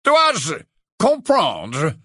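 put-on high, loud haughty old portrait; slow, lofty aristocrat; Phineas Nigellus, slow. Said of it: "dit lentement Phineas Nigellus".

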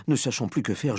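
ordinary delivery, nothing marked

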